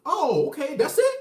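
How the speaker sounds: high, loud mock disbelief; incredulous. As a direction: shoot up in disbelief. Boyfriend stunned by trivial reason.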